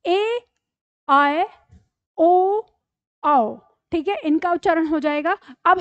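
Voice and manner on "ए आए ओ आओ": ए, आए, ओ, आओ one by one with pauses; repeat-after-me model. Shown as a demonstration. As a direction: recite ए, आए, ओ, आओ as separate vowels for learners. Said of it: "एक बार मेरे साथ आप दोहरा लीजिए". (ए, आए, ओ, आओ रुक-रुककर, अलग-अलग)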